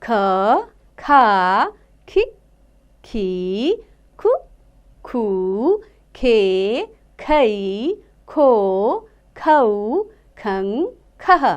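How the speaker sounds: put-on teacher leading children in reciting ख, खा, खी; syllable drill. Shown as a demonstration. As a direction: clear chanting of each syllable for children to repeat. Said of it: "आप भी मेरे साथ साथ बोलिए".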